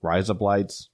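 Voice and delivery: razor blades in an Australian accent. Shown as a demonstration. Showing off the only Australian phrase he knows, Australian accent. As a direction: say it with a broad Australian twang. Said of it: "what's your Australian accent".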